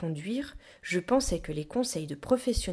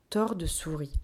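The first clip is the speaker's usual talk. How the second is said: ordinary delivery, nothing marked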